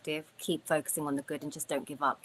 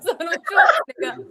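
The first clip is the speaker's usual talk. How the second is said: much higher, louder, cracking up; helpless laughter; 13 dB louder than usual. Real, not acted. Teammate mocking the botched Rocky accent, helpless laughter. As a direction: squeal it out through laughter.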